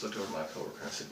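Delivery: ordinary talk, nothing marked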